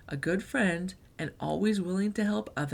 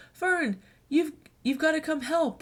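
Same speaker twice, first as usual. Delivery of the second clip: higher, breathless begging; urgent plea; 9 semitones higher than usual. Acted. Unicorns begging Fern at her door, high and urgent. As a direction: rush it like the party's on fire.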